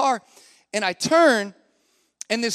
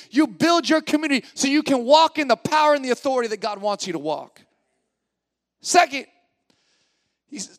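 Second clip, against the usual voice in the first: raised, emphatic sermon delivery; impassioned preaching; 5 semitones higher, 4 dB louder than usual. Real, not acted. Pastor urging his church to find community, impassioned preaching. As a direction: drive the point home to the congregation, pitched up.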